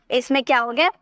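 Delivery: normal talking voice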